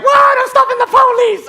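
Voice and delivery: very high, loud mock panic; frantic shriek. Husband tripping out stopping police, frantic shriek. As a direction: scream it out wildly.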